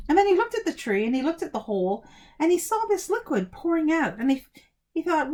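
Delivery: ordinary delivery, nothing marked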